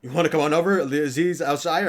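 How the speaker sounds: loud, high mock invitation; playful loud come-on; calling out. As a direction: shout the invitation across the league. Calling out to a free agent.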